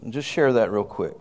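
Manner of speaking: normal talking voice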